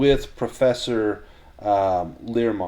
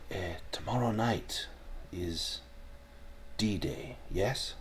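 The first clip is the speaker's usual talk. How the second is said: put-on quiet German-accented conspirator; 9 dB quieter, slower than usual. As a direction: speak softly with a German accent, overheard behind someone. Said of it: "a bit of a German accent".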